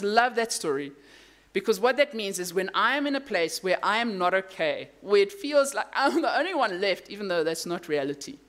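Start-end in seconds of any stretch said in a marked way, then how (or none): none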